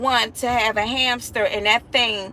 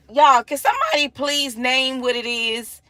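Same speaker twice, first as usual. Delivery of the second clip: usual speaking voice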